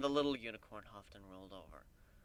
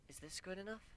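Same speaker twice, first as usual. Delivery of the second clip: soft, higher, tentative; soft offer; 9 semitones higher, 10 dB quieter than usual. A narrator's reading. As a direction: offer it gently.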